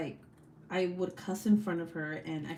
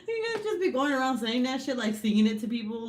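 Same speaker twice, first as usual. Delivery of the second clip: quick, higher, loud, laughing; laughing disbelief; 5 semitones higher, 4 dB louder, faster than usual. Real, not acted. Streamer teases viewers for singing rude rhyme, laughing disbelief. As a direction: scold playfully through laughter.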